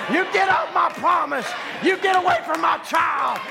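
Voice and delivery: high, shouted revival preaching; full-force preaching. Real, not acted. Preacher rousing the church against the devil, full-force preaching. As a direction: preach it hard, phrase by punched phrase.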